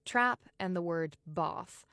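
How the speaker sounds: "trap" with short "a", "bath" with broad "ah"; RP trap-bath split. Shown as a demonstration. Teacher contrasting RP vowels, "bath" said with a broad "ah". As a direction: say "bath" as "bahth", slowly, against "trap". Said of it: "R P accent features a split".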